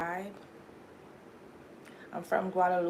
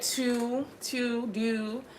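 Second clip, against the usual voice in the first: mock Baltimore accent, hammed up; exaggerated Baltimore accent; 4 semitones higher, 4 dB quieter, much slower than usual. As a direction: drawl it like an overdone Baltimore local. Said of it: "over exaggerating".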